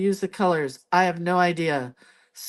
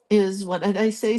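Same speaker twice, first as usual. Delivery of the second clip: usual speaking voice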